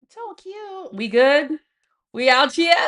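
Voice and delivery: squeaky pet-talk gush. Real, not acted. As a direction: gush over the cute pet. Cooing over the pet.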